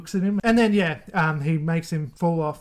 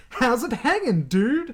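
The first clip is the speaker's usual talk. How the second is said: higher-pitched, jokey surfer-like greeting; botched Chucky imitation; 5 semitones higher, slower than usual. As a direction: throw the line out high and playful. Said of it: "That's not how he says it".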